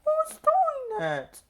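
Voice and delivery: very high, slow, squeaky trucks' question; trucks calling out. A narrator's reading. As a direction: squeal it out slowly like startled toy trucks. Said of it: "they cried".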